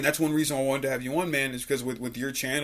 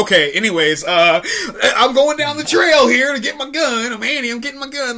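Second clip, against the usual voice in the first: high, laughing mock-girl stage voice; hammed-up actress rushing past his lines; laughing Annie impression; 11 semitones higher, 9 dB louder than usual. Friend imitates co-star covering lines, high and laughing. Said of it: "she would just be like".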